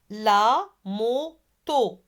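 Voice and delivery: LA MOTO slowly, split la, mo, to; three spaced beats on "La moto"; syllable by syllable. Shown as a demonstration. Teacher models La moto for pupils, slowly syllable by syllable. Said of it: "Allez, répétez".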